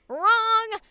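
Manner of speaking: sudden high shouted "Wrong!"; loud exclamation. Acted. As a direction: bark the word out loud and high. Announcer punchline exclamation.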